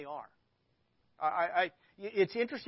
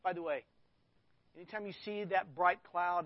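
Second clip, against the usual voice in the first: ordinary talk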